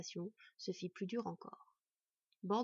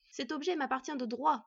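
louder, higher, firm claim; insistent; 5 semitones higher, 5 dB louder than usual. A narrator's reading. Elf persists in claiming the knife, insistent. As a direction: insist on your right. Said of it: "persista l'elfe".

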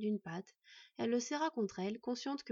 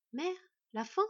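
higher, startled questions; stammered bewilderment; 6 semitones higher, 4 dB louder than usual. A narrator's reading. Boy hearing his mother speak of the end, bewildered. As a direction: falter upward in mild disbelief. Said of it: "balbutia-t-il".

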